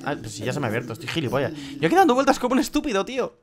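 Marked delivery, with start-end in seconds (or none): 1.8-3.3 high, fast frantic outburst. Real, not acted. Gamer panicking at his own blunder, high and fast.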